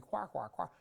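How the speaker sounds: rapid run of evenly spaced quacks; duck quacking. Retold or imitated. Seminar speaker mimics office chatter as a duck farm, duck quacking. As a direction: chatter like a farm full of ducks. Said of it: "like a duck farm".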